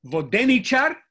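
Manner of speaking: Vodenichar, DE punched high and loud; loud, demonstrative voDEnichar; antepenultimate stress. Shown as a demonstration. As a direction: hit DE in Vodenichar and end on a rise. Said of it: "That's how people talk".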